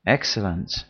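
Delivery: ordinary delivery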